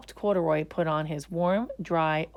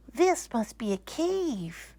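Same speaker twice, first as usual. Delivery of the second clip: slow, higher, contented bear; happy sigh; 6 semitones higher, slower than usual. Acted. Bear delighted by the laundry bag, slow happy sigh. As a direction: sigh it out happily and slowly. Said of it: "sighing happily".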